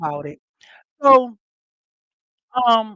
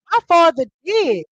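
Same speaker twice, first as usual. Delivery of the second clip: higher, louder quoted comeback; retold retort; 8 semitones higher, 6 dB louder than usual. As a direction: throw back a high retort, imitating a girl. Girl's retort in retold quarrel.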